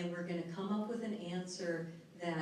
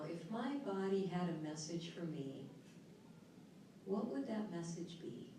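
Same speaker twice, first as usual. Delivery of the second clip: soft, unhurried relaxation guide voice; guided relaxation; 2 semitones lower, 7 dB quieter than usual. Acted. Speaker to seminar audience, guiding a calming exercise. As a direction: ease the room into calm, softly.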